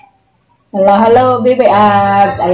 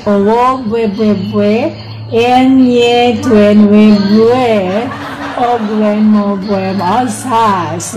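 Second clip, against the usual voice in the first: slow, drawn-out syllable groups; slowly but surely; slower than usual. Real, not acted. Streamer working through a difficult name, slowly but surely. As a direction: stretch "Enye Twen Bwe Bwe" out carefully. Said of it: "Slowly but surely".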